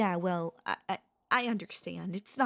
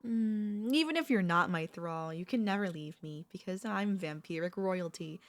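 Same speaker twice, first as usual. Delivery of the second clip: normal talking voice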